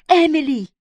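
high-pitched call of her name; desperate cry. A narrator's reading. Barbara begs Emily not to give chase, high cry. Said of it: "задохнулась от крика Барбара".

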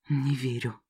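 soft, low breathy whisper. Acted. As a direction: whisper it in disbelief. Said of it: "прошептал Эш".

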